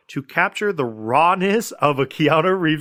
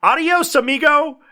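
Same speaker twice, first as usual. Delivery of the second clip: high, loud triumphant farewell yell; imitated movie-hero shout, pitched way up; Bodhi; 13 semitones higher, 6 dB louder than usual. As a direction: holler it out like a skydiver leaping. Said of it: "shot of Bodhi yelling".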